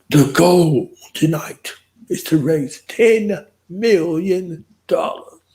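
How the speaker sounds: high, slow, drawn-out announcer call; announcer sound bite. Acted. Host announcing the ten million goal to viewers, big announcer voice. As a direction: boom it out like a game show host. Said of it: "I gotta find a little sound bite".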